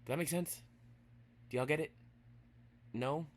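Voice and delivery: high, loud questions to class; teasing check with students; playful loud quiz; excited check-in. Real, not acted.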